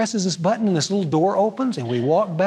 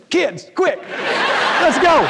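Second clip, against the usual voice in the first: put-on higher, eager call to the kids, through a laugh; excited hurry; 8 semitones higher, much slower than usual.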